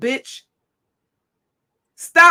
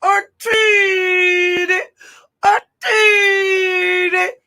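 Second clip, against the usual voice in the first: high, slow, drawn-out mock crying voice; hammed-up sobbing imitation, stretched out; mocking sobbing witness; 5 semitones higher, much slower than usual. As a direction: whimper it like a hammed-up weepy victim. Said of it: "She's talking like the Tin Man on the Wiz".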